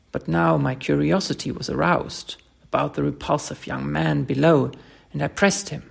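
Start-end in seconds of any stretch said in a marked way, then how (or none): none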